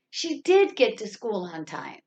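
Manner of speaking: insistent, emphatic did; stress on did. Shown as a demonstration. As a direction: contradict by leaning on did. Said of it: "the word that needs to be stressed".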